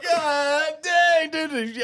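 high, loud, drawn-out exclamation; amazed reaction. Retold or imitated. Onlooker reacts to the liver shot, amazed outcry. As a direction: wail it high in mock astonishment. Retells his reaction to the punch.

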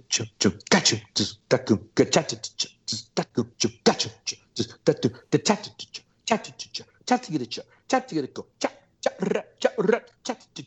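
rhythmic clicked-out snare syllables with rolled fills; beatbox the drum part in crisp percussive syllables; vocalized drum part. Retold or imitated. Drummer showing how his old part went, vocalized drum rhythm. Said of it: "So it's like".

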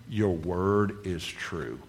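slow, drawn-out declaration; deliberate confession. Shown as a demonstration. Preacher recalls his one line of faith, slow and deliberate. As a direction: let each word stand, very slowly.

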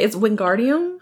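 WINGARDIUM with "dee-um", no extra r; correct pronunciation. Shown as a demonstration. Suggested correction for Ron, WINGARDIUM said "win-GAR-dee-um". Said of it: "that's what she should have said".

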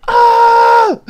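very high, loud mock scream; long piercing cartoon-attack scream. Scream during solar flare joke.

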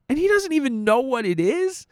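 incredulous. Real, not acted. Incredulous at senator.

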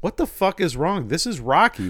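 lightly acted bewildered outrage; mock bafflement. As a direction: exclaim it in puzzled protest. Retelling own baffled reaction.

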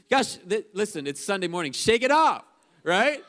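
loud, high exhortation, falling hard on 'off'; rousing preacher's shout. Real, not acted. Preacher to Sunday morning congregation, rousing shout. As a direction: call it out to fire up the room.

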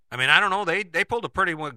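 ordinary delivery

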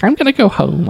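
put-on high, breezy girl's voice. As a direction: quote her lightly and brightly. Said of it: "and she's like".

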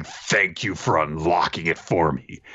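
a touch of low villainous menace; demonic Senku. Retold or imitated. Retelling Senku's sinister thanks in the recap, lightly demonic. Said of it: "like, demonic Senku saying".

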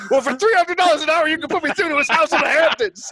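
insist, outraged at the fee; indignant caller. Retold or imitated. Patient yelling at the doctor's office, high indignant demand. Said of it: "He's like".

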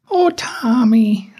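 high, slow motherly "Oh, Tommy"; imitating mom. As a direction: do his mom, drawn out. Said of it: "That's their impression of my mom".

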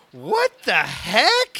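slow, drawn-out, high "what the heck"; retold shock, stretching every word; stunned disbelief. Retold shock at the cop's threat.

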